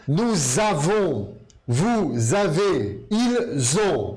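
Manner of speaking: "Nous avons, vous avez, ils ont" slow, each z linked; slow liaison drill. Shown as a demonstration. Teacher drilling conjugation for students, slow and clear. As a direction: drill the z liaisons slowly, word by word. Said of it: "Som de Z".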